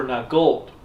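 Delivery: normal talking voice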